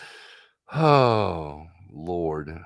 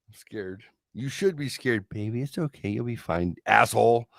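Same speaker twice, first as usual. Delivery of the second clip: ordinary talk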